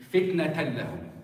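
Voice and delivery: "Fitnatan lahum" with the n swallowed into a clean l; no ghunna. Shown as a demonstration. Teacher proving the nun disappears, clean double l in "Fitnatan lahum". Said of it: "There is no nun, there is no ghunna at all".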